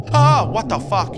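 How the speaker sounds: loud, high yell of shock; startled scream. Real, not acted. Player hit by a sudden scare, loud high scream. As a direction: yell it out in real fright.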